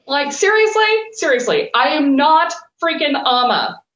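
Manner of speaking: high, incredulous protest, no real volume; mock exasperated scream. Coach protesting to Spirit, mock exasperated scream. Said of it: "I'm screaming at them".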